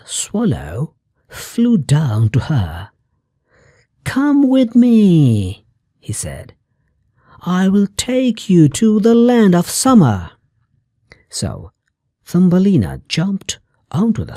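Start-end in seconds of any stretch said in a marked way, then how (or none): 4.0-5.6 a high, slow bird voice calling her to come; eager swallow. A narrator's reading. Swallow rescues Thumbelina. 7.4-10.3 eager swallow. A narrator's reading. Swallow promises summer land.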